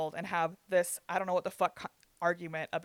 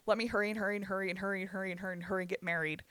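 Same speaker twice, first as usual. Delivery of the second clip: 1 mock rushed; faster than usual. Mocking cousin's rush to marry.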